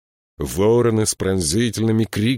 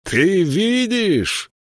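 high, long-drawn call; shouting after someone; 13 semitones higher, 4 dB louder, much slower than usual. A narrator's reading. King calling after the departing count, drawn-out high shout. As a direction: stretch it out and cry it high. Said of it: "крикнул ему вслед Элиас".

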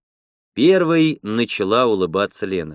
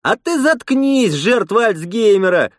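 angry cry; 10 semitones higher, 4 dB louder than usual. A narrator's reading. Lena to the giggling old attendant, angry cry. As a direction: yell the insult up high. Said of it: "выкрикнула Лена".

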